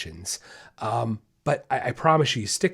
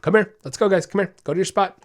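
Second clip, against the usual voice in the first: higher, louder firm calls to the dog; brisk trainer commands to a barking dog; sharp redirection; 5 semitones higher, 4 dB louder than usual. Shown as a demonstration. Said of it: "'cause that's what I do. Say".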